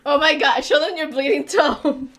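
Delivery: ordinary talk, nothing marked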